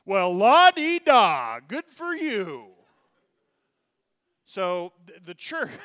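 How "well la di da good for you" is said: slow, loud, high sarcastic sneer; mocking la-di-da. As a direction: scoff at it with a loud sing-song. Mocking the tame rite.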